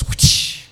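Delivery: loud breathy whoosh; whoosh sound. Retold or imitated. Bruce Lee slapping his student, loud whoosh. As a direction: blow out a quick slap noise. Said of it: "Bruce Lee just goes".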